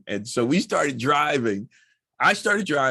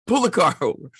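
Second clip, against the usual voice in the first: louder, laughing command; 4 semitones higher, 4 dB louder, faster than usual. Retold or imitated. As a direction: order it while laughing. Ordering wife to stop.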